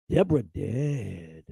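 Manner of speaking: put-on low, slow, hollow mumble of a broken old man; mock senile father muttering; imitating dazed father. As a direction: drop low and slow, staring blankly. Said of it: "he whirled and go like this".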